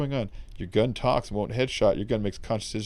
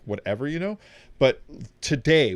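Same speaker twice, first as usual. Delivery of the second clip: normal talking voice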